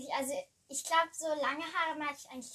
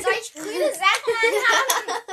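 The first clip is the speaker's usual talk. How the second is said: incredulous; 9 semitones higher, 14 dB louder than usual. Real, not acted. Joking about nature style.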